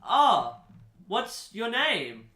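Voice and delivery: a hint of a wide-eyed child asking; Drew Barrymore voice. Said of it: "Drew Barrymore goes".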